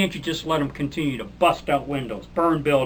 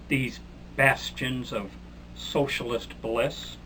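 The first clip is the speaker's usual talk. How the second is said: slow, softer mocking "socialist bliss"; slowed ironic praise, softer; slow sarcasm; 5 dB quieter, slower than usual. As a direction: savour each word with scorn.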